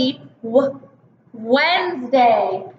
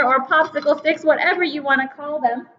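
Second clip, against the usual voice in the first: usual speaking voice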